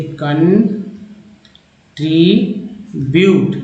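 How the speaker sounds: very slow "कन ... tribute" with long gaps; broken into syllables. Shown as a demonstration. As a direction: sound out "कन tribute" chunk by chunk. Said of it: "टी आर को tree बोलते हैं".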